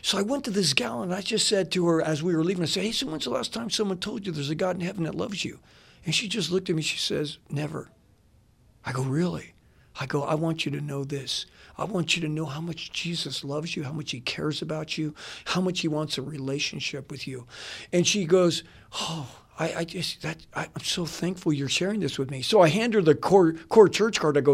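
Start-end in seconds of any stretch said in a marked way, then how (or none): 2.8-17.5 much softer, tender witness; gently telling the waitress God loves her, softly; gentle sincere. Retold or imitated. Sharing faith gently with waitress. 18.9-22.4 much softer, moved thanks; quietly touched, grateful; waitress thanking him, softly moved; touched gratitude. Retold or imitated.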